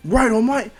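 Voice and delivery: put-on high, loud, keen kid; eager acted reply. Izuku answering All Might before take-off, eager.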